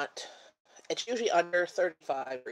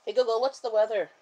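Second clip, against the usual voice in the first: raised, clear command voice; talking to voice assistant; 6 semitones higher, 5 dB louder than usual. Real, not acted. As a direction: speak up to the smart speaker.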